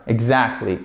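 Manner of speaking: ordinary talk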